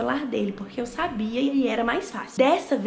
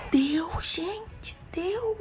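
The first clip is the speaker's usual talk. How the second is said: excited whisper; 8 semitones higher, much slower than usual. Real, not acted. Phone unlocks after many tries.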